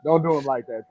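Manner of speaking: ordinary delivery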